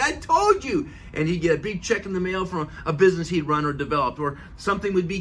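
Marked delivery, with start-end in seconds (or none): 0.0-0.9 much higher triumphant gloat. Retold or imitated. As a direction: crow it happily. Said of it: "he'd call him and say".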